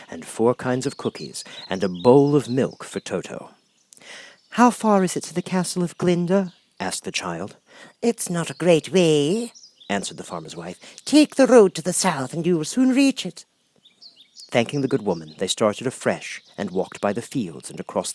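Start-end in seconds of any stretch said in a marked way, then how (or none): 4.5-6.5 put-on high, worried child voice; girl voice. Dorothy asking friends what to do, high worried girl. 8.0-13.4 lightly voiced farmer's wife. Farmer's wife pointing Dorothy south, kindly high voice.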